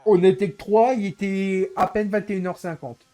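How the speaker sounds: loud, high exasperated outburst. Real, not acted. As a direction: blurt it out, fed up. Exasperated about the failed open.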